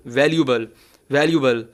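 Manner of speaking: वैल्यूएबल repeated as "val-yu-bal"; correct pronunciation. Shown as a demonstration. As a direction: drop the "ay" from वैल्यूएबल, falling at the end. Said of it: "इसका सही तलफ़ुज़ है".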